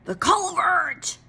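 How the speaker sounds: high, slow shout of triumph; yelled discovery. A narrator's reading. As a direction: yell it as you spot the hiding place. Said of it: "yelled Mister Wagon Wheel".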